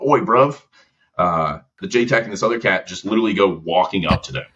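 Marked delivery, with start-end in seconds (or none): 0.1-0.6 mock British "Oi, bruv", pitched up; a quick cheeky British accent impression. British accent tossed in while retelling the JTAC's partner. Mimicking British JTAC greeting.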